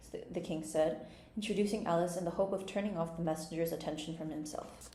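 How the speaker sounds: ordinary delivery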